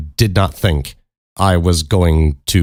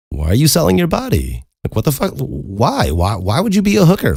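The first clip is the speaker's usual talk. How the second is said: high-pitched incredulous why; baffled questioning; 8 semitones higher than usual. Retold or imitated. Teller re-enacts asking her why, baffled and incredulous. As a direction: demand a reason, pitch jumping up.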